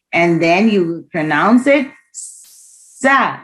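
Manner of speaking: slow, with "sa" drawn out as a long hiss. Shown as a demonstration. Teacher modelling the snake-like sa for the class. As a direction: stretch the s of "sa" like air leaving a tire. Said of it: "snake sound".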